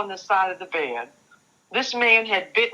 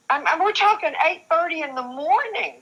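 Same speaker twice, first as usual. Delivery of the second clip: real tears rising, pitch jumps higher; struggling not to cry as she says it; voice breaking; 9 semitones higher than usual. Real, not acted. Survivor telling interviewer the hour of the assault, voice breaking into tears.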